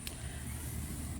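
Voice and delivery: hard choking cough; coughing fit. Real, not acted. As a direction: cough out a swallowed bug. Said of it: "I think I just swallowed a bug".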